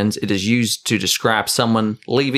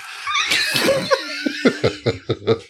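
laughing. Real, not acted. Cracks up at own joke.

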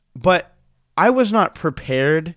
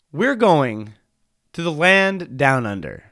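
normal talking voice

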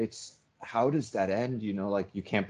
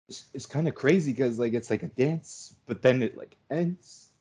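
tearful, emotion breaking through; teary; 4 semitones higher, 3 dB louder than usual. Real, not acted. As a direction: voice rising and straining with tears. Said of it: "I'm getting, like, teary".

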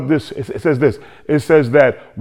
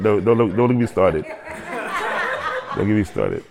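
laughing aside; 5 semitones lower, 9 dB quieter than usual. Real, not acted.

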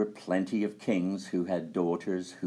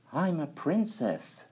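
princess voice; 9 semitones higher than usual. A narrator's reading. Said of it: "answered the maiden".

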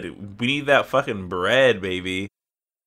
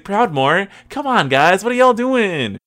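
higher, louder, rising disbelief at the server; exasperated plea; 8 semitones higher, 5 dB louder than usual. Retold or imitated. Gamer scolding the whole server, exasperated and pitched up. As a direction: come on, guys, what gives. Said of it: "And I was like".

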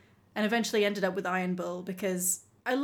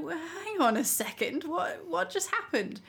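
baffled protest; 8 semitones higher than usual. Retold or imitated. Acts her confused reaction.

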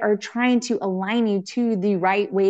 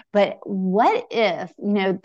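usual speaking voice

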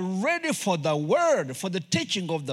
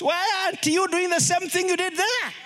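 usual speaking voice

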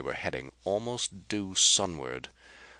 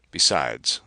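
ordinary delivery

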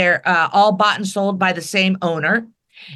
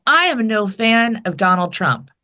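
usual speaking voice

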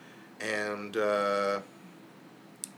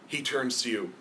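ordinary talk

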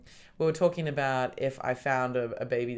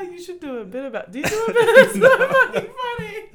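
high, loud, laughing; can barely speak for laughing; laughing hard; 12 dB louder than usual. Real, not acted. Howling laughter at the joke.

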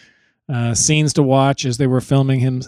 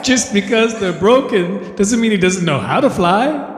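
higher-pitched mock Beatle voice; [name]; 6 semitones higher than usual. Songwriter defending his broken-wing lyric, high mock Beatle voice. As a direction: argue it in a raised, put-on Liverpool voice. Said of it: "Oh, Paul".

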